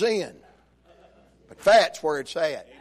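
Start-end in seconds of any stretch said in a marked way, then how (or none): none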